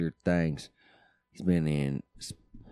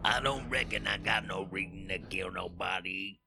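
soft drawled movie impression; Carl Childers; 6 dB quieter than usual. Co-host repeats the trailer's line, soft drawled impression. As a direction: echo the backwoods character softly.

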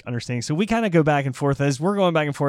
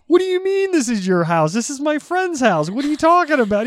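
high, loud baffled arguing; acted-out incredulous objection; retold indignant protest; 12 semitones higher, 6 dB louder than usual. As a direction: argue back, pitched way up. Argues back in retold quarrel.

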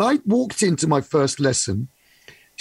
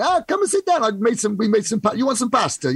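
welcoming Italian host; 7 semitones higher than usual. Retold or imitated. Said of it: "people always turning up for dinner".

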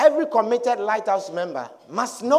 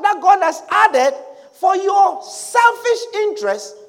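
raised, forceful sermon delivery; emphatic preaching; 8 semitones higher, 6 dB louder than usual. Acted. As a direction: preach it to the congregation, pitch lifted.

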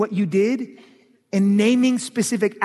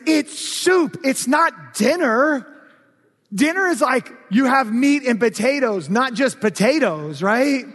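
higher, louder, indignant hungry husband; frustrated outburst; 6 semitones higher, 4 dB louder, slower than usual. Retold or imitated. Husband going off at his wife over soup, higher and louder. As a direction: lecture her in mock outrage. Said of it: "I get so frustrated and I go off".

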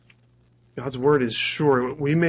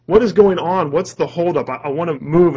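exasperated outburst, higher and louder at first; impatient driver; 3 semitones higher, 6 dB louder than usual. Acted. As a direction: demand to know what the holdup is. Acts impatient driver stuck in traffic.

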